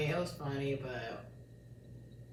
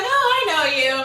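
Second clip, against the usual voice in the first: mock cop recognition; 7 semitones higher, 8 dB louder than usual. Police recognizing her.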